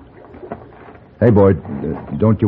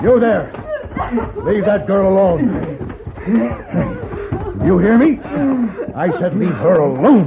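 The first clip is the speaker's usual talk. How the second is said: commanding shout; 10 semitones higher than usual. Acted. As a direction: bark an order to back off. Ordering attacker off girl.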